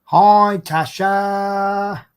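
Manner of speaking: ordinary delivery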